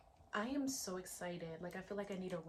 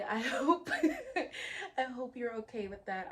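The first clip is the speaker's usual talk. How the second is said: real laugh breaking up the words, high and loud; 5 semitones higher, 10 dB louder than usual. Real, not acted. Host laughs to viewers over her own wordy speech, laughing. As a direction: burst out laughing mid-sentence, pitch jumping up.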